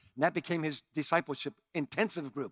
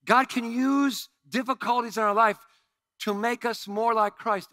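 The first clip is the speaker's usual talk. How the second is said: higher, louder preaching build; 6 semitones higher, 6 dB louder than usual. Real, not acted. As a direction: lift them with conviction. Preaching climax.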